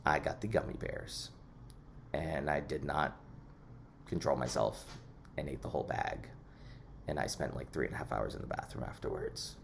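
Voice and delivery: low, hushed, sheepish admission; sheepish confession. Real, not acted. Confessing an embarrassing gummy bear story.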